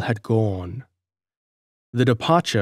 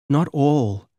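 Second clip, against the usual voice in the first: sorrowful; 4 semitones higher than usual. A narrator's reading. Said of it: "she replied sorrowfully".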